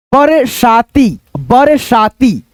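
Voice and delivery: बरसाती drawn out slowly; slow reading. Shown as a demonstration. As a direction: read बरसाती slowly for a beginner. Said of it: "धीरे धीरे पढ़ना". (धीरे धीरे पढ़ना)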